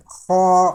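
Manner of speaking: خا loud and heavy from the upper throat; heavy kha. Shown as a demonstration. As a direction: push خا out full and heavy. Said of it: "اس طرح کر کے".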